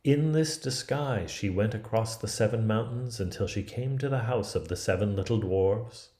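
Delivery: ordinary delivery, nothing marked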